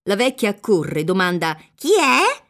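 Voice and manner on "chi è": high, loud question through a door; raised, high-pitched challenge; calling out. A narrator's reading. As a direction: call out sharply to whoever knocks. Old woman calls through the door.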